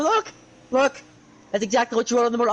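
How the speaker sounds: high, fast indignant protest; indignant exclamation. Retold or imitated. A student proving his work to the teacher, indignant and high. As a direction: shove the paper at her, indignant and quick.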